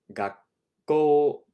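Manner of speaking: 学 then a catch, then 校 fired out loud and high; pause then fire. Shown as a demonstration. Teacher showing the class the geminate stop, pause then fire. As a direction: stop on the double K in 学校, then burst into 校. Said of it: "You're almost pausing and then firing".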